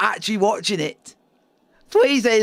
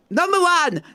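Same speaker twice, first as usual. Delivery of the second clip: lightly put-on Japanese accent, higher; 7 semitones higher than usual. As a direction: chant it in a hint of a Japanese accent. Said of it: "I can do the Japanese accent".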